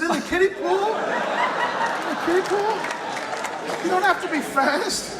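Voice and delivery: high, laughing disbelief; incredulous. Retold or imitated. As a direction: retell it like you still can't believe it. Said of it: "I said".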